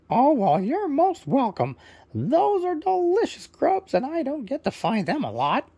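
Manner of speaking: high, loud squawky parrot; proud parrot introducing himself, high and loud. Acted. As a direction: chirp it out as a cheerful parrot bird. Parrot replies to thanks.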